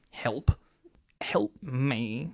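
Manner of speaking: low, soft joking cry for help; hammed-up pitiful plea; mock plea.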